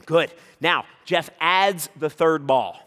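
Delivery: ordinary talk, nothing marked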